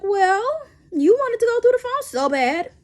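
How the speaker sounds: high, loud sassy comeback; sassy retort. Retold or imitated. Her retort to boyfriend.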